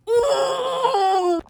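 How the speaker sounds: long, high groan of frustration; frustrated groan. Real, not acted. Venting about mom denying it's AI, frustrated groan. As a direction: let out a sustained exasperated "ooh". Wordless frustration while recalling anger.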